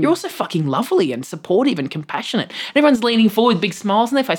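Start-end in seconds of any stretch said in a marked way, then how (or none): 0.0-2.5 high, overwhelmed confession to the crowd; flustered gushing. Retold or imitated. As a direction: babble it out, caught off guard by kindness. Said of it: "I just went".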